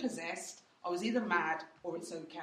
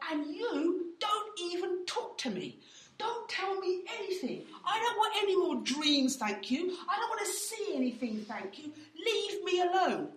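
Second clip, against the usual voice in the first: exasperated outburst; 9 semitones higher than usual. Retold or imitated. Telling God to leave her.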